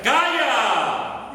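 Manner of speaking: very high, very loud drawn-out shout; commanding shout. Acted. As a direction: roar the order at wind and storm. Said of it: "Yo creo que Jesús dijo al". (grito de mando a gran voz)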